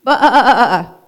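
high, loud calling baa; loudest bleat. Acted. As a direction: bleat as loud as you can. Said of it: "called Daisy as loud as she possibly could".

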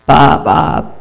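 wavering sheep's bleat. A narrator's reading. Sheep calls out to disguised Turkey, bleating. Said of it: "bleated Sheep".

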